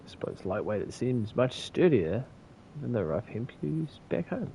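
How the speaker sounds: ordinary delivery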